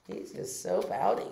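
usual speaking voice

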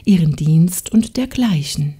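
plain reading voice

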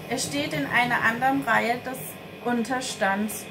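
usual speaking voice

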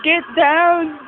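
loud, high shout. Real, not acted. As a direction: bark the command across the room. Shouting at friends for video.

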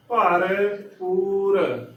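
ਭਰ ਪੂਰ very slow, with a clear p in ਪੂਰ; slow correct pronunciation. Shown as a demonstration. Teacher sounds out the word slowly for the congregation, clear p. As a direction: stretch out bhar poor, pressing the p. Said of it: "ਪੱਪੇ ਨੂੰ ਦਲੈਂਕੜ".

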